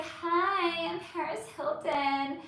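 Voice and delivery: put-on high little baby doll voice; lightly imitated baby doll voice. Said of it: "that whole, like, baby doll voice thing".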